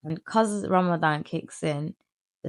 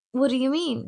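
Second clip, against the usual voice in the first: mock innocent; 9 semitones higher than usual. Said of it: "No, I didn't even say".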